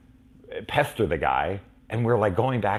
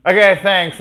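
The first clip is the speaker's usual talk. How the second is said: much higher, louder sheepish thanks; embarrassed quick thank-you; awkward thanks; 12 semitones higher, 12 dB louder than usual. Retold or imitated. Kids thanking the star, high and awkward. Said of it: "we're like".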